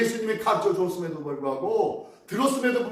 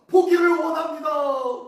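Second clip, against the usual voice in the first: loud, high-pitched plea; impassioned cry; 8 semitones higher, 6 dB louder than usual. Acted. Blind man longs to see again, impassioned cry. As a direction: cry out the longing at full voice.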